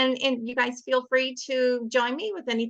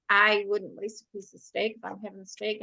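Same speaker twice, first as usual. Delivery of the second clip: usual speaking voice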